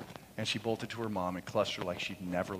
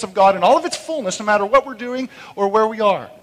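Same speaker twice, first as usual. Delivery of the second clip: high, loud, soaring preacher's cry; ringing pulpit proclamation; preaching at full force; 8 semitones higher, 13 dB louder than usual. Acted. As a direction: proclaim it to the back row. Preaching scripture and hope.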